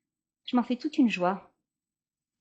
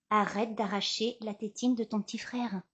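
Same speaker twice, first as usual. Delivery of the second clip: normal talking voice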